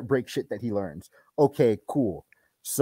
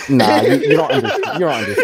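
laughing protest; 3 semitones higher, 3 dB louder than usual. Real, not acted.

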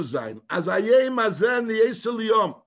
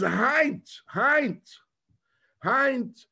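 raised-pitch, emphatic cry, not a full shout; mildly raised retold outcry; retold scream; 6 semitones higher, much slower than usual. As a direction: imitate the Rebbe crying out, each word set apart. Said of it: "Heint, the Rebbe screamed".

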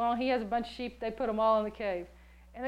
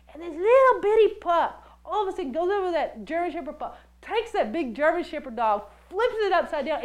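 ordinary talk, nothing marked

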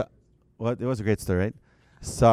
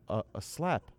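usual speaking voice

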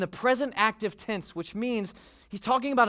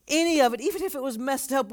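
ordinary talk, nothing marked

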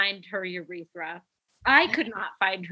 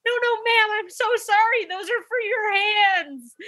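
much higher, flustered new nurse pleading; new grad voice; 13 semitones higher than usual. Retold or imitated. Colleague imitating a new grad nurse apologizing, high and flustered. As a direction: squeak it out like a nervous new grad. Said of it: "in her new grad voice".

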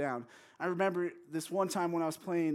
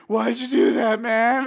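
loud, higher-pitched mock-crying yell; hammed-up tearful protest; 5 semitones higher, 13 dB louder than usual. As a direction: act out a kid yelling through tears. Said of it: "crying, yelling, like".

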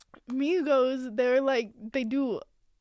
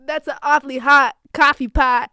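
[name] quote; 9 dB louder than usual. Retold or imitated. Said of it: "No, he said".

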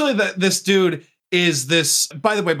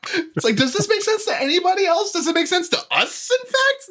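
high laughing voice. Pretend. Laughing high-voiced boxer aside.

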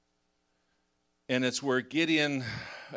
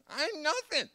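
whiny Gideon; 14 semitones higher, slower than usual. Retold or imitated.

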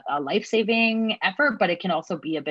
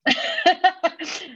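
emotional laugh; 11 semitones higher, 5 dB louder than usual. Real, not acted. Laughs after emotional message.

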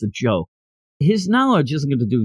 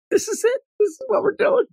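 very high-pitched disbelieving squeak; thin, high incredulity; incredulous; faster than usual. Real, not acted. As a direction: question it way up high, can't believe it. Host incredulous at the story's joke.

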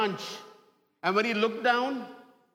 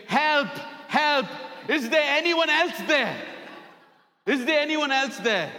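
high, mock desperate calling; retold scream for help; 5 semitones higher than usual. Man still clinging to the branch, mock screaming for someone else. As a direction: cry out higher, as an acted plea for rescue. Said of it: "And then he screamed again".

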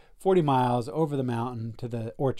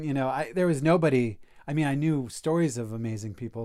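ordinary talk

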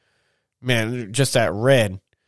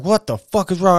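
higher, louder retold yell; mock angry yell; 6 semitones higher, 4 dB louder than usual. Yelling at the driver who cut him off, mock angry. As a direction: bark it like he's about to fight. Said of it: "I just got like".

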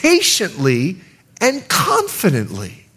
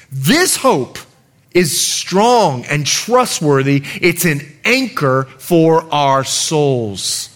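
slow, lower scripture reading; slow solemn reading; 5 semitones lower, much slower than usual. Shown as a demonstration. Pastor reading Hebrews to the church, slow and solemn. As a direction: read the verse slowly and gravely. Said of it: "Listen carefully".

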